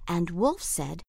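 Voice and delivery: ordinary delivery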